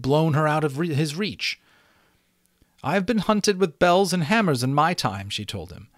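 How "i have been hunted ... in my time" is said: high, lofty unicorn voice; proud unicorn. A narrator's reading. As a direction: tell the hunter of it proudly, pitched high.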